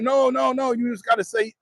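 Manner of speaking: high-pitched pleading objection; frantic caller, imitated. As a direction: imitate someone cutting in to correct. Retold caller frantically correcting him.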